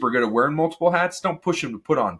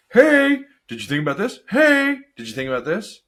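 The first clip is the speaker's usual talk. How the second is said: sharp, much higher "Hey" then a pointed question; pushy interrupter; 11 semitones higher, 5 dB louder than usual. Retold or imitated. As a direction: butt in like a know-it-all. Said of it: "I don't have to be like".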